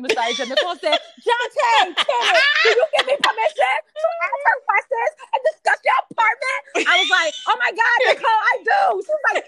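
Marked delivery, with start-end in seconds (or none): 1.2-6.9 high, loud, sarcastic request with laughter; laughing mock-formal shout. Friend loudly and sarcastically asking the tenant's permission. 7.5-9.0 loud mock answer. Playing along, loud.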